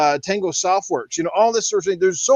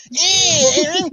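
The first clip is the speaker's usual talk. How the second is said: much higher, sneering troll voice; mocking troll; 9 semitones higher, much slower than usual. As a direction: taunt him like an online troll. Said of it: "some little shit stick comes on and goes".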